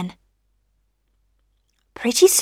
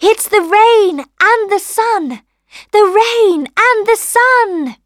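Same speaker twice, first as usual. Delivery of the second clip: high, loud, eager little callers; two little voices; 11 semitones higher, 6 dB louder, slower than usual. A narrator's reading. Rain and sun answering rosebud, high little voices. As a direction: chant it out high like small visitors. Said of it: "said two little voices together".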